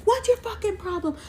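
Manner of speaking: imitated angry driver, very high-pitched; high, strained retold yell without full volume; mock screaming. Bus driver yelling at a pedestrian, mock screaming. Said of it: "he starts screaming".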